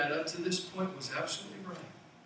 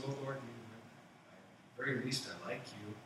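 quiet, embarrassed, much softer mumble; sheepish Peter; 4 semitones lower, 6 dB quieter than usual. Retold or imitated. Peter answers Jesus after denying him, quiet and embarrassed. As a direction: hedge it like a guilty friend. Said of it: "Peter said".

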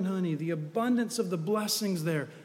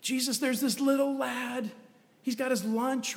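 Andrew; 7 semitones higher than usual. Acted. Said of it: "Andrew stepped up".

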